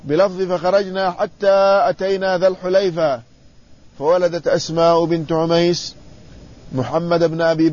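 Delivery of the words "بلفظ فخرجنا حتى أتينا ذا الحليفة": ordinary delivery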